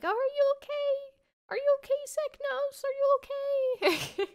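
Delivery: very high falsetto worried fangirls; mock high-pitched concerned girls; fussing girls. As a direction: coo over him in a squeaky girly voice. Girls fussing over Seknos.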